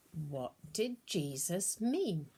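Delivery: plain reading voice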